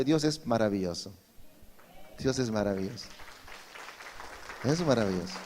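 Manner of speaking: soft, low, reverent; hushed awe. Real, not acted. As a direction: marvel at it quietly. Preacher's soft awed repetition.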